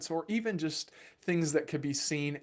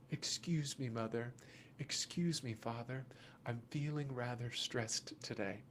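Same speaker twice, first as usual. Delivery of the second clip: much softer, lower mock-polite child; 5 semitones lower, 12 dB quieter than usual. Parent showing what the scream really means, softly and lower. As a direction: hush it, a meek little polite request. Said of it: "is their best way of coming and saying".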